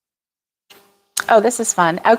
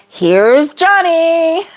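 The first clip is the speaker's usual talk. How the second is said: high, slowly stretched catchphrase; playful sing-song film-villain taunt; Shining movie impression; 7 semitones higher, much slower than usual. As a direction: draw out each word and lift the end. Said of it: "that was The Shining".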